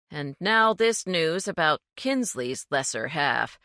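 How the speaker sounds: plain reading voice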